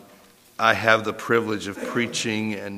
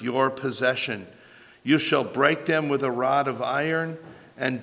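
raised, measured Bible reading; scripture reading; 5 semitones higher than usual. Acted. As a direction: read the psalm with weight. Reads the psalm aloud.

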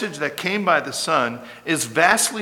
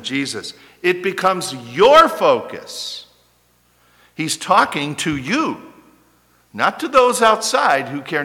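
loud, slowed pulpit insistence; forceful preaching; 2 semitones higher, 5 dB louder than usual. Acted. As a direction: press the point on believers.

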